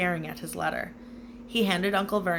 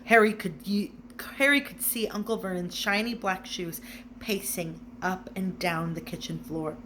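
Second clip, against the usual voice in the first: plain reading voice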